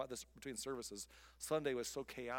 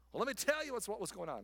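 higher, louder mock rant; mock venting friend; ranting complainer; 7 semitones higher, 4 dB louder, faster than usual. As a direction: start the tirade. Imitating someone venting.

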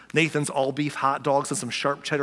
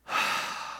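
long, breathy, weary sigh, no voice; deep sigh; 8 dB quieter than usual. Shown as a demonstration. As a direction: let all the air out like you're done with them. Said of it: "he sighed deeply in his spirit".

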